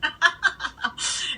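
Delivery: a bright burst of laughter; high relieved laugh. Real, not acted. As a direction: laugh out the tension. Relieved after saving the mix.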